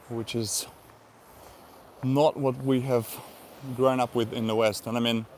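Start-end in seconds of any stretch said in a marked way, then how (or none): none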